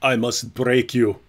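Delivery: slow mock Ukrainian accent; Ivan Drago. Host obliging co-host's request for an accent, slow mock Ukrainian. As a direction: put on a slow, heavy movie-boxer Ukrainian accent. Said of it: "can you do a Ukrainian accent?".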